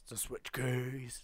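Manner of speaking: low, unexcited echo of a catchphrase. Pretend. Sing-song repeated catchphrase, low answer.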